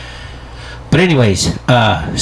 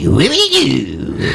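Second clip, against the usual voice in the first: slow, higher goofy Sandler voice; lightly done Sandler character voice; Adam Sandler impression; 5 semitones higher, much slower than usual. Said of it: "Now you sound like Adam Sandler".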